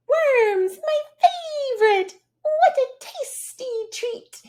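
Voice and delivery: very high, loud chirpy bird. Acted. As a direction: squeak it out like a tiny bird. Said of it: "You could twitter and cheep".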